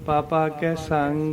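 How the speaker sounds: slow model reading of ਪਾਪਾ ਕੈ ਸੰਗ; slow light papa. Shown as a demonstration. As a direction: carefully spell out ਪਾਪਾ ਕੈ ਸੰਗ with no dot. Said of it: "ਇਸ ਤਰ੍ਹਾਂ".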